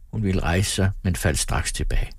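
plain reading voice

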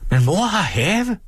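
high, slow call from the yard. A narrator's reading. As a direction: call it out across the courtyard. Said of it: "råbte hun fra gården".

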